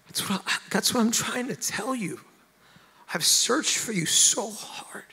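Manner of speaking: high, strained cry to God, breaking into whispery breath; anguished plea. Retold or imitated. Praying on the couch to a missing God, anguished. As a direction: plead high, dragging out the last words. Retold desperate outcry to God.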